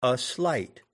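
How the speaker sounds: "a slight" as an iamb, weight on SLIGHT; light "a", then land on "slight"; stress on SLIGHT. Shown as a demonstration. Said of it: "if you emphasize the word slight".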